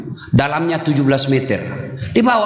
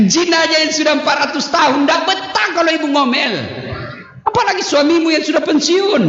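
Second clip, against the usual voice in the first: much higher, shouted punchline; 10 semitones higher than usual. Real, not acted. Preacher delivering the joke to the wives, shouting high. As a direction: hammer the punchline home.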